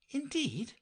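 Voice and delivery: high, questioning attempt at heartiness; hearty as possible. Alice answers the knight, trying to sound hearty. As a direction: lift it high, trying to agree. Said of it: "she said it as heartily".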